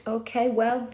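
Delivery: ordinary delivery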